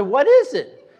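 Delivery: high, loud asking; excited question. Retold or imitated. Young man pressing the leader again, high and eager. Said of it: "I said".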